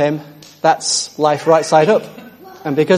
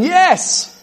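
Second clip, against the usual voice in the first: high, thrilled cry of joy; excited yes; 13 semitones higher than usual. Real, not acted. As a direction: cheer it out, delighted by the promise. Preacher exults over scripture.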